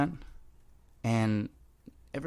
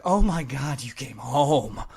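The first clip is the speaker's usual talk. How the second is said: excited dog voice; 3 semitones higher, 6 dB louder than usual. Pretend. A dog greeting its owner home, overjoyed and loud. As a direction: play the dog beside itself with joy. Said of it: "your dog is like".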